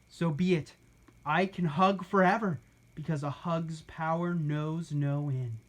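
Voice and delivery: usual speaking voice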